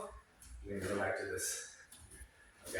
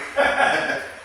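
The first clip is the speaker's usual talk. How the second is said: bright burst of laughter; laughing; 12 semitones higher, 8 dB louder than usual. Real, not acted. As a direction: laugh it off loudly. Laughs at own story.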